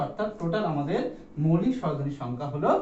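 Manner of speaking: usual speaking voice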